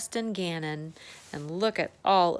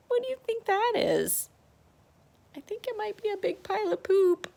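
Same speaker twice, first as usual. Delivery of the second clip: high, giggly silly voice; cheeky high voice about poop; playful; 12 semitones higher, faster than usual. Real, not acted. Silly guess for the child.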